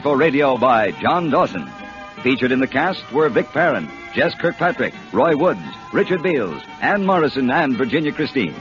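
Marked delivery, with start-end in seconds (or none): none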